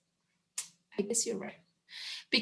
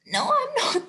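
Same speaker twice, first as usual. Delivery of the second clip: high squeaky denial; mock denial. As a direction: squeal it back. Protesting again.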